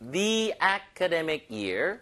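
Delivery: "the" said as "thee" before "academic"; slowly, a long ee in "the", leading into "academic"; "thee" before a vowel. Shown as a demonstration. Teacher shows the article before a vowel, "the" as "thee". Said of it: "the로 읽어야겠네요".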